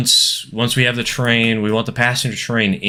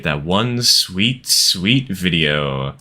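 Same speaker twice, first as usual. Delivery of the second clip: drawn out savoring; 2 semitones lower, much slower than usual. Real, not acted.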